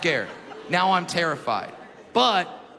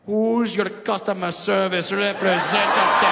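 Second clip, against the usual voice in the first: put-on slow, louder Indian accent; 2 semitones higher, 5 dB louder, slower than usual. Indian man whispering in ear in slow Indian accent. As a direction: purr it slowly in an Indian accent. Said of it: "whisper something soft and sexy in your ear".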